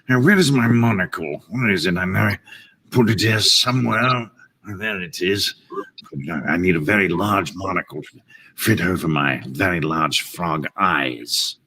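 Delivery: snooty, refined British frog; fussy upper-crust British murmur; James Mason. Acted. Frog hunting for his monocle, snooty British manner. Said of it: "a little bit of James Mason in there".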